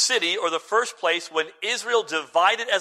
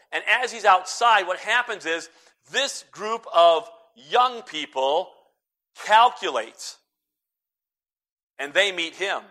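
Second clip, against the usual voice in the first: ordinary talk, nothing marked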